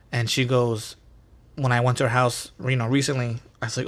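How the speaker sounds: ordinary talk, nothing marked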